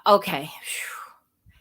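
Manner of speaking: whispered, slow sigh of relief; whispering to herself once calm at last; relieved whisper. Retold or imitated. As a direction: breathe it out, barely voiced. Calming down after phone call.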